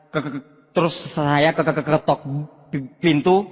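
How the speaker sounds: low mimicked stammer; stuck in bursts on first syllables; stuttering storyteller. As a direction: stutter even the knocking sounds. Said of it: "si Gagap".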